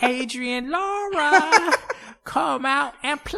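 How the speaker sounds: very high, loud singsong call; Warriors-style taunt. Pretend. Gang calling out Adrian Laura in England, high drawn-out taunt. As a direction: screech it with the last word drawn way out. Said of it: "imagining a Warriors-esque encounter".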